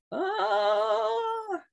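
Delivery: a high, far-off woman's wail; woman's cry. Retold or imitated. A woman's voice rising over the silent crowd, high wail. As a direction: let out a single high call from a balcony. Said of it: "se siente esta voz de una mujer".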